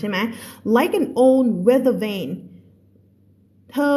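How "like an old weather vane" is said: slow, careful read-aloud of a novel; teacher reading a story to a class. A narrator's reading. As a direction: read the passage slowly for learners. Rereads line.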